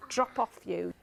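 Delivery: ordinary delivery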